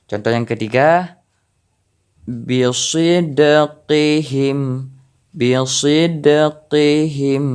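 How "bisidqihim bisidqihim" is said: very slow "bisidqihim" with the d bouncing; qalqalah on dal. Shown as a demonstration. Teacher demonstrating qalqalah, slow bounced dal. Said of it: "dal ini harus ada pantulan".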